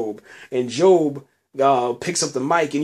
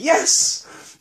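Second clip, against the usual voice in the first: much higher, breathy cheer; mock fan cheering; cheering yes; 13 semitones higher than usual. Fan cheering at the screen, high breathy cheer. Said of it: "you're just screaming".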